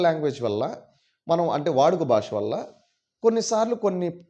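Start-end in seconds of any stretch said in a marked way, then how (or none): none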